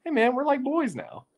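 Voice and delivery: high, chummy bro voice; buddy voice. Retold or imitated. Coach and reporter suddenly buddies, high chummy tone. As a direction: chirp it up like best friends now. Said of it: "it went from ... to".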